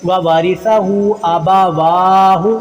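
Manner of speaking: व वारिसा हू आबा वाहू slowly, waa held long; lengthened waw. Shown as a demonstration. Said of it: "वाव को लंबा करेंगे". (आबा वाहू में 'वा' लंबा)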